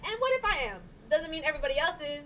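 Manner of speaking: defiant retort. Retold or imitated. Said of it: "I'm like".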